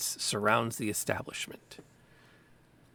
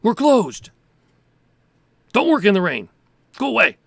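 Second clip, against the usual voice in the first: loud, high shout through a shut door; irritated yell from inside the shop; shouting shopkeeper; 8 semitones higher, 11 dB louder than usual. Acted. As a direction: shout the callers away, pitch high and falling. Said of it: "shouts, you hear a voice".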